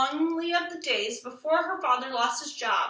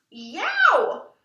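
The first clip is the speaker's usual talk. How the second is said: sudden high yelp; a sharp ouch as hair is tugged; loud high yelp of pain; 7 semitones higher, 6 dB louder than usual. Acted. Hair pulled by sister.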